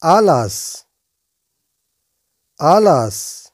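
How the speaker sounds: ألاس said as alas, slow and clear; Spanish word drilled. Shown as a demonstration. Teacher to viewers, repeating alas clearly. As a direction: give ألاس carefully, falling at the end. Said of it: "أي الجناحان".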